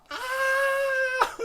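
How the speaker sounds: high, held mock scream; re-enacted scream. Host retelling big acupuncture needles going into the muscle, mock scream. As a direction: hold a high comic scream, no real pain. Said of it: "I would be screaming out loud".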